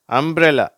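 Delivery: emphatic UMBRELLA for learners; MBR blend demo. Shown as a demonstration. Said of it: "அப்ப எப்படி வருது".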